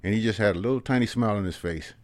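ordinary talk, nothing marked

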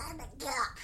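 high, soft little duck voice; Little Webster duck voice. Pretend. Radio host's duck puppet answering at the mic, high soft duck voice. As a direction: pitch it way up and quiet, like a tiny duck. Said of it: "the little duck voice I do".

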